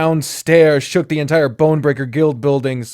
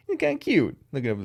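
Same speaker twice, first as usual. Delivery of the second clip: ordinary delivery, nothing marked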